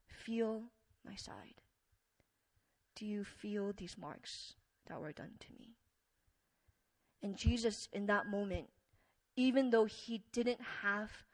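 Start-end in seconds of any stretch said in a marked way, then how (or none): 0.0-5.7 hushed and gentle, like calming a frightened friend; gentle whisper. Acted. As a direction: whisper it tenderly, barely voiced. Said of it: "ever so gently and he says".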